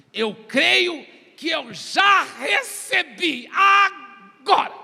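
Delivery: slow, high, full-force shout; shouted declaration. Retold or imitated. Preacher declaring faith in his retold prayer, shouting. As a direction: proclaim it loudly, stretching every word.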